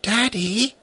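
mysterious whisper. Acted. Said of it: "in a mysterious whisper".

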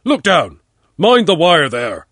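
shouted order, high and forceful; loud warning. A narrator's reading. Hidden watcher warning from a tree, loud shout. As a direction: call out sharply to those below. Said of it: "A loud voice came from above".

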